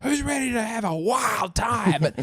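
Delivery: high, slow emcee hype yell; hype emcee shout. Retold or imitated. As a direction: pump up the crowd. MC hype after acknowledgement.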